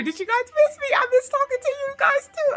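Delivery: sing-song greeting pitched far above her own; fond, squeaky hello to the viewers; cutesy high voice. Real, not acted. Greets viewers after a break.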